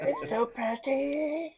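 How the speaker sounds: high, slow, swooning sigh; mock swooning. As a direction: drawl it like you're entranced. Player mocks charmed friend.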